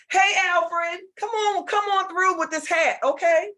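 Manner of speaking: high-pitched welcoming call; excited greeting. Real, not acted. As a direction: hail a friend who just arrived. Greets Alfred arriving on screen.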